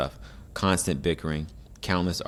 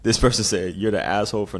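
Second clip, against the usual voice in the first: laughing; 4 semitones higher, 6 dB louder than usual. Real, not acted. Laughing at the comment.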